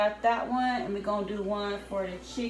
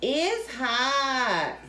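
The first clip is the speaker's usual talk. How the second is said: imitating the kid; 4 semitones higher than usual. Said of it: "the one you say".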